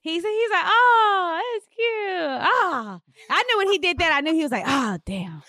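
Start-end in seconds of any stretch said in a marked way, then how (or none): 0.7-3.0 high, drawn-out mock admiration, sinking away; imitating husband's cooing. Husband reacting to card, acted. 4.7-5.4 imitating dismayed realization. Husband realizing he forgot, acted.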